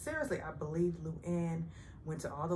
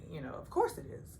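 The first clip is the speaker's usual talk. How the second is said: usual speaking voice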